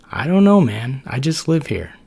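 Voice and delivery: clueless buddy brushing it off; Max the macrophage. Acted. Max voiced.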